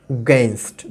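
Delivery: "against" almost like "gainst", the a barely heard; weak opening vowel. Shown as a demonstration. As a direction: say "against" low, swallowing the first a. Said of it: "আটা কিন্তু শোনাচ্ছে না". ("against"-এর শুরুর আ প্রায় না শোনানো)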